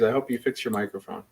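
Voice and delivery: ordinary talk, nothing marked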